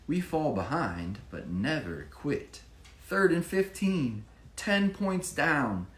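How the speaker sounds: picture-book narration rising to the big play; rhyming story reading. A narrator's reading. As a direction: build the tension of the comeback for a child. Reads rhyming story aloud.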